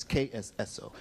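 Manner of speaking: usual speaking voice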